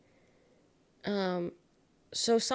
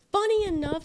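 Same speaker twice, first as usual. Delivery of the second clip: higher and louder, playful emphasis; excited; 10 semitones higher, 6 dB louder than usual. Real, not acted. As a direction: punch it out with glee. Second take, high and loud.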